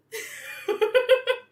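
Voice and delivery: high, loud laugh bursting out; burst of laughter. Real, not acted. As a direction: laugh off the rant. Laughing at her own rant.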